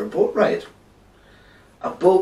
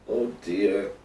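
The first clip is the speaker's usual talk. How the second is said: low, weary groan; despondent groan; 5 semitones lower, 4 dB quieter than usual. Acted. Jonah despairs on the stormy boat, low groan. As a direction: groan it under a sigh, lower. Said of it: "groaned Jonah".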